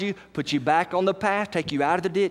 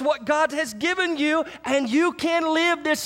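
full-force preaching; 10 semitones higher than usual. Acted. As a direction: lift the room, ring out each grace.

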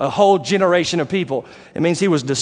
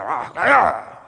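grumbling noise; 5 semitones higher, slower than usual. Retold or imitated. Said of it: "And they're".

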